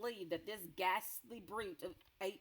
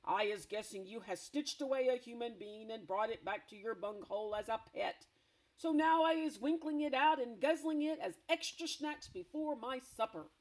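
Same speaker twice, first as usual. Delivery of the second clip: loud, high gloating giant voice; greedy giant; 4 semitones higher, 6 dB louder than usual. Acted. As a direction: relish the threat. Giant threatens to eat human.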